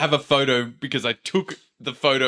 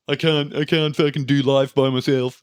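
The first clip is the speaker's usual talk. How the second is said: higher, put-on helpless wail; mock helplessness; 5 semitones higher, 3 dB louder than usual. Admitting helplessness to a friend, mock-helpless. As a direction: play up being hopelessly unable to cope.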